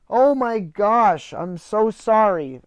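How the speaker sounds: loud, drawn-out, real dismay; dismayed apology. Real, not acted. Host realizes he locked his cat out, dismayed apology. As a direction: break off and apologize, stretching the words out loudly.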